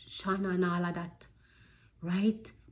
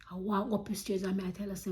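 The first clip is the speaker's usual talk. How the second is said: usual speaking voice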